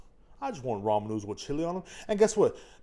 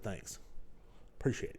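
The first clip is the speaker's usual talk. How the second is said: deadpan, much softer mock gratitude; sarcastic thanks; 6 semitones lower, 12 dB quieter than usual. Speaker thanks the dog for the mess, soft and sarcastic. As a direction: thank him dryly, unimpressed.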